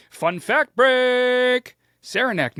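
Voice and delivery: high, loud, drawn-out jingle call; big stretched-out announcer holler; segment announcement shout. Pretend. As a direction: belt it out like a game-show segment title. Calling out a segment title.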